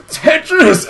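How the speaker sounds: high, loud mock death cry; cartoonish high-pitched outburst; dying exclamation. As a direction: shout it out as a hammed-up last word. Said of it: "He goes".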